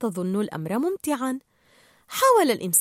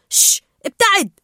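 loud, higher shooing command; shooing away; 10 semitones higher, 8 dB louder than usual. Acted. Marlin pushing the jellyfish from Dory, shooing sharply. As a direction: shush it and drive it off.

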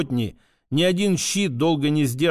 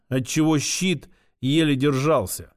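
plain reading voice

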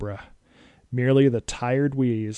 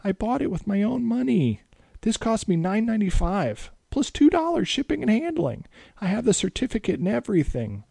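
put-on very high boy's voice, speeding up; a kid pleading his case; whiny kid; 10 semitones higher than usual. A narrator's reading. Boy arguing with Dracula over his amulet. Tommy protests.

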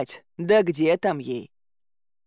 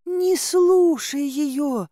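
high, long drawn-out plea; pleading maiden; 9 semitones higher, much slower than usual. A narrator's reading. Girl begs Dobrynya to ignore heroine, high pleading. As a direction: beg in a high, stretched girlish voice. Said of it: "взмолилась девушка".

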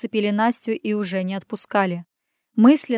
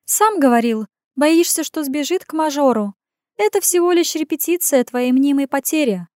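teasing sister; 5 semitones higher, 4 dB louder than usual. Acted.

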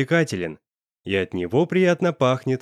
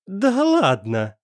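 smirking; 7 semitones higher, much slower than usual. A narrator's reading. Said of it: "Усмехнулся Шум".

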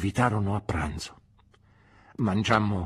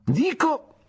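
high, louder bright restart; pompous I say, higher; Micawber resuming; 5 dB louder than usual. Acted. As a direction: bounce back into it at once. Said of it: "riprese Micawber".

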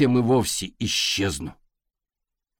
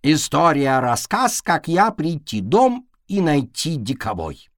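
mocking broken speech; 5 semitones higher, 3 dB louder than usual. Cat mocks the fox's speech.

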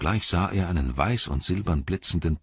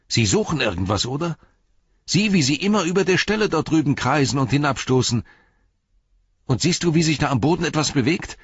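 high, agitated calling; 8 semitones higher than usual. A narrator's reading. Pippin reporting circling riders to Beregond, high urgent calling. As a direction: point out each sight in rising alarm. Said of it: "rief er".

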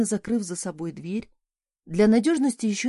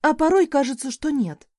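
higher, louder irritated comeback; a sharp, higher and louder retort in irritation; snapped retort; 6 semitones higher, 5 dB louder than usual. A narrator's reading. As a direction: snap back at the boy. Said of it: "рыкнула в ответ".

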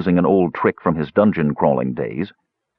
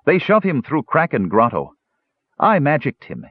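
high, dim-witted dwarf voice; much higher childlike dwarf; simple dwarf voice; 7 semitones higher than usual. A narrator's reading. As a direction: sing it out like a cheerful dolt. Numskull explains, broken speech.

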